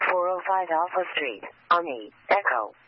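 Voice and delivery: ordinary delivery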